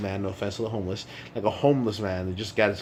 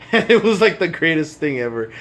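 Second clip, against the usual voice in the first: laughing; 8 semitones higher, 11 dB louder than usual. Real, not acted. Laugh while recalling burritos.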